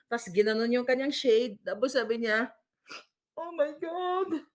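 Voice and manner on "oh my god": high, slow, drawn-out imitation of wailing; mock distress. Host mocks woman's fake crying on camera, mock distress. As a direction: draw it out as hammed-up distress. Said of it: "Parang akala mo, ginagahasa".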